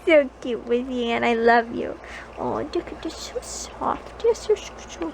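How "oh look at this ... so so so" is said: soft breathless whisper to the pet; whispered adoration. Real, not acted. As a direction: hush and marvel at the soft fur. Whispering over the soft dog.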